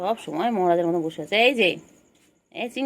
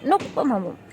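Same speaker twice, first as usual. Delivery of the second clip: ordinary talk, nothing marked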